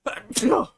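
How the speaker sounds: sharp real sneeze; explosive sneeze; loud sneeze. Real, not acted. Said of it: "I'm gonna sneeze".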